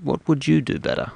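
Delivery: normal talking voice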